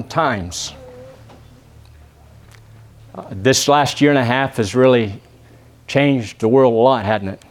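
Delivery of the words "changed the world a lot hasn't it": ordinary talk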